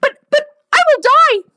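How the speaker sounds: hammed-up horror at the workout; mock dying wail. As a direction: wail it like a dramatic client. Client panics about dying.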